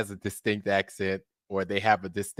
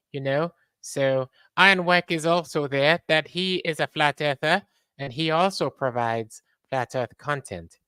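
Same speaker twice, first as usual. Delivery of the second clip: raised, put-on voice of a fellow streamer, held through; imitating Flat Sword; 7 semitones higher than usual. Streamer jokingly imitates fellow creator Flat Sword, raised put-on voice. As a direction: mimic another streamer talking up his content. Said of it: "That's my impression of, of Flat Sword".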